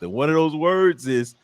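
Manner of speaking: normal talking voice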